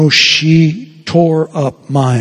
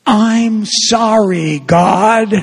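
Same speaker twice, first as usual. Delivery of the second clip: slow, a touch raised; said loudly; 7 semitones higher, much slower than usual. Shown as a demonstration. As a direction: lead the kids in a loud apology. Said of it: "let's say loudly".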